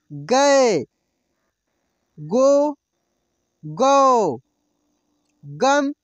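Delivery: each syllable called out slowly and loud, with pauses; slow drill recitation. Shown as a demonstration. Teacher reciting syllables for students to repeat aloud. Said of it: "आप भी मेरे साथ बोलिए दोस्तों".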